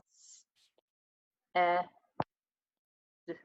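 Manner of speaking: each sound of s- eh d- split apart with long pauses. Shown as a demonstration. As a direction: segment s- eh d- one sound at a time. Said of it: "you would say".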